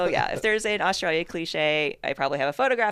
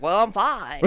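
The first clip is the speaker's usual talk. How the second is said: put-on deep South accent; 7 dB louder, slower than usual. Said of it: "his American accent, which is always deep South country".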